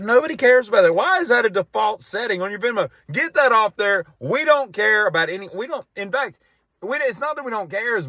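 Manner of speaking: high-pitched indignant complaint; exasperated rant. Real, not acted. Comedian scolding people who share Venmo history, exasperated rant. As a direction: pitch it up, fed up and insistent.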